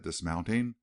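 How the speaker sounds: plain reading voice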